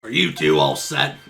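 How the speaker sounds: smoker's voice. Acted. Lunch lady in the detective game asks if they're all set, low smoker's voice. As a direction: drag the words out low like a heavy smoker. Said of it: "Honey, you smoke way too much".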